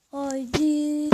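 loud rallying cry; excited shout. Real, not acted. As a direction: call it out to start the fight. Starting the match, cheering.

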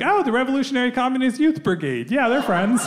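high-pitched, cheerfully unguarded admission; blithe recognition. Retold or imitated. Comic to police about the group, blithely recognising them. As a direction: recognise old pals without a worry.

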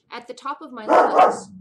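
full-volume shout; very loud shout. Real, not acted. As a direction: belt it out. Describing the neighbor's yelling.

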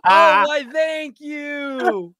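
very high, loud squeal of thanks; hammed-up falsetto gratitude; mock gushing. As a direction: gush it in a silly high shout. Mock-gushing thanks amid laughter.

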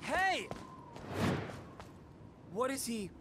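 shout. Acted. Calls out to a stranger.

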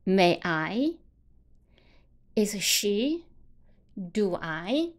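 "May I？Is she？Do I？" each drawn out and lifted at the end; rising question tune. Shown as a demonstration. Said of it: "语气末尾的时候把声音往上翘". (“May I”“Is she”“Do I”句尾都往上翘)

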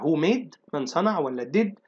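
ordinary delivery

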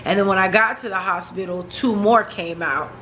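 ordinary talk

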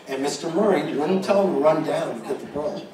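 normal talking voice

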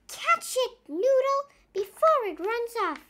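high, excited call; eager urging. Acted. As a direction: hurry him on, high and keen. Naughty Paul urging.

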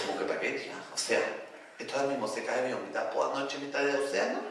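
lightly imitated posh girl, low and quiet. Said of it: "atrás tenía yo a dos pijas". (voz de pija, grave y bajita)

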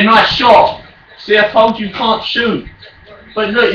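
usual speaking voice

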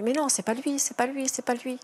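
plus aigu, suppliant : c'est pas lui; répète-le comme un refus désespéré; déni désespéré. Retold or imitated. Mère refuse la mort de son fils. (une mère qui refuse la nouvelle, voix aiguë et suppliante)

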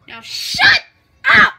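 loud, forceful order to be silent; hammed-up irritated yell; angry command. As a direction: shout it with a break before the last word. Snaps before ending video.

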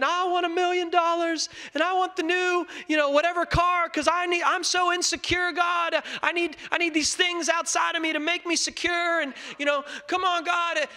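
high, mocking needy pray-er; mock insecure prayer. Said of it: "I wasn't saying".

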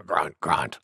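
low, soft boar grunts; deep piggy "grunt, grunt". Acted. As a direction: grunt deep after the big sneeze. Wild Boar grunts after sneezing.

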